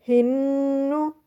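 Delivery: हिन्नू with the नून held in the nose for two seconds; हिन्नू with the doubled नून sent through the nose; nasal ghunna. Shown as a demonstration. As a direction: stretch the न्न of हिन्नू into a nasal hum. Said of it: "नाक में देखिए आवाज़ लेके जानी है".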